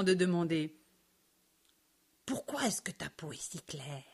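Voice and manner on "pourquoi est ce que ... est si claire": soft unvoiced whisper; hushed question to a new friend. A narrator's reading. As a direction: ask it in a secret whisper. Shy curious question between friends.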